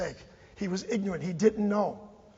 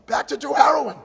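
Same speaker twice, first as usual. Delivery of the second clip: higher, much louder outraged punchline; exasperated shout; 5 semitones higher, 9 dB louder than usual. Real, not acted. Speaker tells officials where revived kid goes, exasperated shout. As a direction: burst out loud and high.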